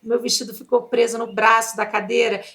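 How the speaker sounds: normal talking voice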